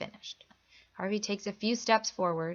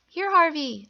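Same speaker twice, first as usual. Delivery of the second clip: higher, louder call to the dog; 8 semitones higher, 8 dB louder than usual. A narrator's reading. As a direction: call him over for a treat. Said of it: "Olivia calls".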